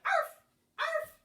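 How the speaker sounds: high, loud yappy barks; dog barking. Acted. Teacher barking as the dog in the song. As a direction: bark like a little dog. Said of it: "The dog said".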